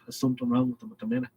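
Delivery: usual speaking voice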